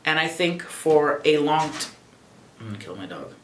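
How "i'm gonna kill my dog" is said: much lower, much softer grumble; annoyed aside about the dog; muttered aside. Real, not acted. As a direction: mutter it off mic.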